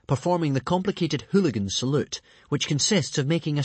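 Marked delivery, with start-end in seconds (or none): none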